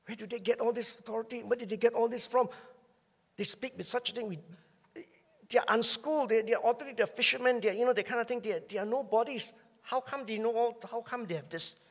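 high, loud amazed townsfolk; incredulous onlookers. Retold or imitated. Crowd questioning the apostles, high incredulous voices. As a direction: marvel out loud in disbelief. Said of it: "what was things they said about them".

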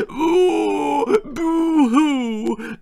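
very high, loud fake cry; mock wailing boo-hoo. Daughter throwing a tantrum over the seat, fake wail. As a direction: howl it like a spoilt brat. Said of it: "temper tantrum".

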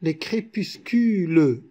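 "crépuscules" ending in a clearly voiced "-le"; "crépuscules" syllable by syllable, final E sounded; counted final E. Shown as a demonstration. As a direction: say "crépuscules" as cré-pus-cu-leu. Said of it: "on a compté le E".

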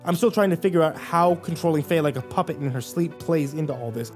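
ordinary delivery

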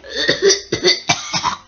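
acted sputtering coughs; coughing fit. Controller caught in Gordon's steam, coughing. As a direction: cough repeatedly, as if choking. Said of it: "The controller started coughing".

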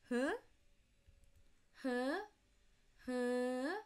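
"huh" from mid, ending high rapidly; questioning high tone. Shown as a demonstration. Teacher likening Thai tone to English, "huh" rising sharply. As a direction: ask "huh" with a late upward flick. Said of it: "start with the comfortable mid pitch".

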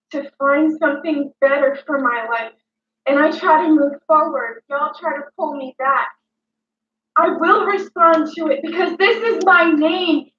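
ordinary delivery

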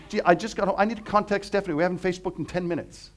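put-on high, loud whiny teen voice; mock teenager. Speaker mocking a teenager who needs Facebook, high and loud. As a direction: play a gushing teen desperate to message a friend. Parody of a teenager coming home.

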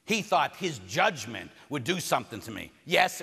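ordinary delivery

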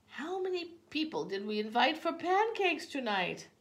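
high, loud demand; exasperated question. A narrator's reading. Mama to her son heading home, exasperated questioning. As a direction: demand an answer from the child, pitched high.

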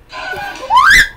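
high shrieking laugh. Real, not acted. Jump scare reaction.

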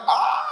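very high comic "Ah!"; mock awestruck cry. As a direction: let out a drawn-out, high-pitched cry. Youth distracted by girl in worship.

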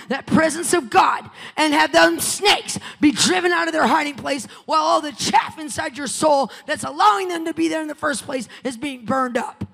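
loud, rising preacher shout over music; preaching at full force. Acted. Preacher to the congregation, full-force shouting sermon.

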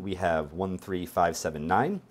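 normal talking voice

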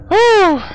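high, loud whew of relief; loud relieved whew. Real, not acted. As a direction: blow out the fright. Relief after scare.

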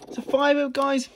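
high, loud happy announcement; excited. Real, not acted. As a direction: cheer the small win out. Revealing a fiver win.

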